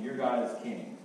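ordinary delivery

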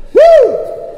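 high, loud "woo"; short high celebratory whoop; excited whoop. Acted. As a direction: whoop for joy like the healed man. Whoops as the excited healed man.

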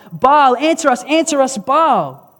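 loud, high chanting plea; shouting cries. Retold or imitated. Worshippers calling on Baal to answer, shouted cries. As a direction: cry out desperately to a silent god. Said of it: "burst into shouting and cries".

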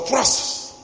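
sharp unvoiced "fras" like a claw swipe; swipe sound. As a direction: spit out a quick swishing slash noise. Imitating a sudden swipe. (sonido de zarpazo, seco y susurrado)

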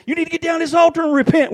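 loud, high pulpit exhortation; mock fiery altar call; imitating a preacher. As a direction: call sinners down like a fired-up preacher. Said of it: "Preacher gets up and preaches".

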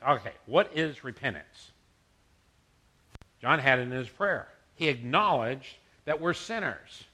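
ordinary talk, nothing marked

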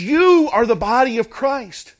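"You" stretched high and loud; emphatic. Retold or imitated. Preacher quoting Paul to the believers, emphatic. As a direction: drive "You" home, high and forceful. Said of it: "in a very emphatic way".

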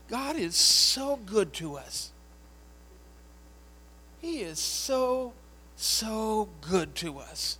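slow, high, tear-choked praise; tearful old woman. Retold or imitated. Dying woman weeping to her pastor, slow and tearful. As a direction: voice Madeline weeping with gratitude. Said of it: "she's literally crying".

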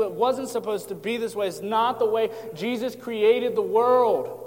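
high, loud, driving sermon crescendo; impassioned preaching lament. Acted. Preacher voices Jesus lamenting the broken world, impassioned.

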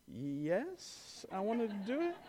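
a brave face barely holding; timid forced yes. Retold or imitated. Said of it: "And Parker's like".

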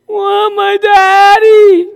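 put-on high, slow, crying little girl voice; whiny toddler. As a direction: wail for daddy like a tired toddler. Grandchild crying for father.

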